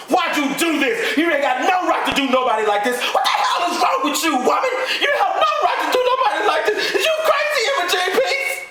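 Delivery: high-pitched furious, anguished shouting; enraged screaming. Acted. Husband pinning wife to floor, screaming in rage.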